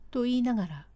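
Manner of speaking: ordinary delivery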